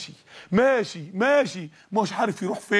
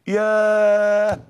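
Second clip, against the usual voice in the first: a long, louder drawn-out "yaah" of pain; stretched, louder pained cry; long pained exclamation; 5 dB louder, much slower than usual. Acted. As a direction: let it ring out like a blow to the heart. Second blow to the heart.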